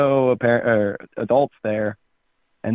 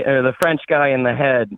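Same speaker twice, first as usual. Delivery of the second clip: ordinary delivery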